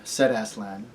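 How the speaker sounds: ordinary delivery, nothing marked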